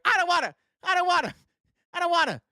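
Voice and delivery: put-on high childish whine; whiny refusal. Mocking voters who won't open their eyes, high childish whine. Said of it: "and you're like".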